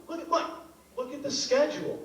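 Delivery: usual speaking voice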